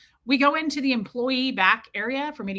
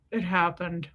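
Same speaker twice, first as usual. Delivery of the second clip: lower, mock-discreet confession; side-of-mouth aside; 4 semitones lower, 3 dB quieter than usual. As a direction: confess from the corner of your mouth. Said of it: "Why do people think that that's discreet?".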